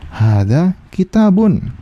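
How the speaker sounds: slow and drawn out, word by word; slow dictation. Shown as a demonstration. Teacher spelling out an example sentence to write, slowly. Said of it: "kita tulis nih".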